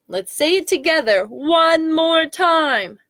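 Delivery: high, drawn-out rallying call; slow it down and build excitement; excited rallying. Pretend. Getting children to say it together, high excited rallying. Rallies children for final shout.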